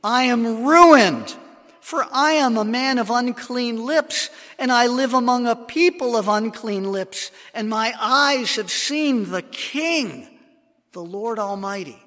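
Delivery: anguished cry. Acted. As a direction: cry out as a man undone before God. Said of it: "Isaiah cried out".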